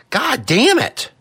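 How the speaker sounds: high, drawn-out cry of frustration; exasperated curse. Real, not acted. Host cursing the broken adapter, exasperated. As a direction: curse slowly at a high pitch. Adapter fix fails on air.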